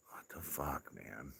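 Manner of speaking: breathy whisper, barely voiced; whispered disbelief. Real, not acted. Whispering to himself about the lawnmower comment. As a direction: let it slip out under your breath.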